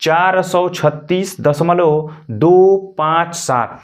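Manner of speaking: slow, each decimal digit said apart; slow digit-by-digit reading. Shown as a demonstration. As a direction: read it out slowly for the class, digit by digit. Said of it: "हम कैसे पढ़ेंगे?".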